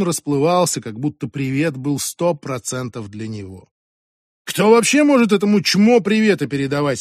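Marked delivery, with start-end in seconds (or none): 4.5-7.0 higher-pitched jeering question; derisive, as if laughing at him; mocking amusement. A narrator's reading. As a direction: scoff in amused disbelief. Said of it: "смеялся Аси".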